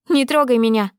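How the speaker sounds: loud, high furious «Не трогай меня!»; angry shout. Acted. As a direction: snap «Не трогай меня!» at the father. Daughter pulls away from father.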